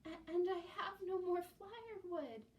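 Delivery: put-on a cold old lady trembling; shivering old woman. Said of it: "said the old woman".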